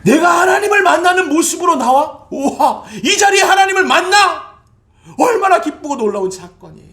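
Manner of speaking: high, loud shouted questions with a laugh; joyful preaching. Acted. Pastor rejoicing before the congregation, joyful preaching. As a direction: shout for joy at meeting God.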